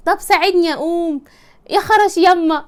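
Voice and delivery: hammed-up helpless groaning; mock aching plea. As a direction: play up the aches while asking for help. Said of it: "انت بتتوجعي".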